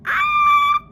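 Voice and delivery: sudden high yelp of pain; loud high ah shriek. Retold or imitated. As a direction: shriek as if it hurts. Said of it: "And I'm like".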